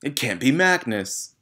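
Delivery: high, loud, slow horrified wail; panicked denial. A narrator's reading. Trunchbull crying out that it can't be Magnus. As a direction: refuse to believe it, crying out. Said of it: "she cried".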